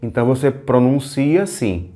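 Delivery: ordinary talk, nothing marked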